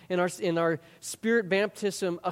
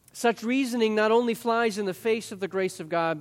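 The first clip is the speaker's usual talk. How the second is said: ordinary delivery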